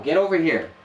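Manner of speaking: high, loud order; loud, high command to a pet; commanding the dog. Real, not acted. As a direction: order the dog over firmly. Scolding the barking dog.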